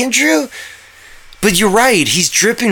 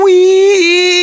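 excited; 10 semitones higher, 4 dB louder than usual. Acted.

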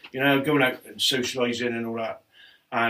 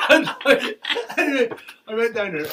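drawn-out laugh, much higher; 11 semitones higher, 4 dB louder, slower than usual. Real, not acted. Remembering the harbour house, slow laughing. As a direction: stretch it slowly while still laughing.

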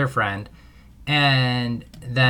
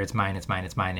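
ordinary delivery

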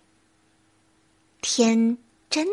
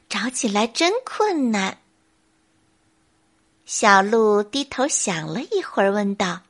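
plain reading voice

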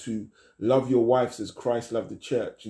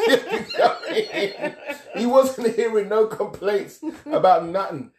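laughing; 10 semitones higher, 3 dB louder than usual. Real, not acted. Laughing at own joke.

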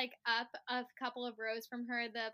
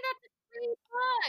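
excited; 11 semitones higher, 5 dB louder than usual. Real, not acted. Excited reaction to book.

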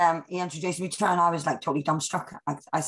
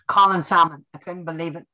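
ordinary delivery

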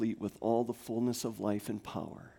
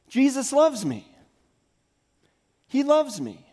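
higher, louder proclamation; proclaiming; 12 semitones higher, 8 dB louder than usual. Real, not acted. Pastor proclaiming to congregation, loud and high. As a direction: ring it out for the room.

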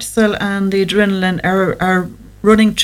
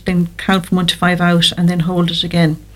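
ordinary delivery

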